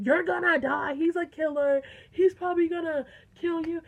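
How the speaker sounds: loud, high-pitched doomsaying; hammed-up warning as the panicking friend; mock alarmist warning.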